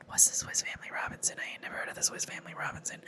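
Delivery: hushed, fast whisper of playful disbelief; mock whisper. Whispering back at mom's memory. Mocking her own ignorance, whispered.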